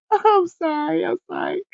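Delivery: apologizing through high giggles; laughing apology. Real, not acted. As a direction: try to say sorry but keep cracking up. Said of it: "I can't even get it together".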